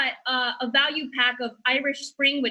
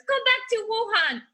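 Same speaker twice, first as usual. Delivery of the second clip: retold heckler yell; 9 semitones higher than usual. Comic recalling abuse at airports, mock yell. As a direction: imitate a stranger yelling a taunt. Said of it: "people yelling at me".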